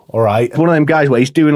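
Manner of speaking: ordinary talk, nothing marked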